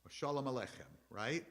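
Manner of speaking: ordinary talk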